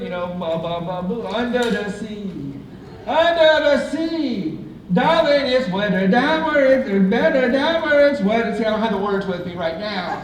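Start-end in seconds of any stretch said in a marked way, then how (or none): 3.1-8.6 put-on Jamaican crab accent. Comic singing karaoke on a cruise in Jamaican crab accent. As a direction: belt it like the Jamaican crab from the cartoon. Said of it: "Jamaican crab accent".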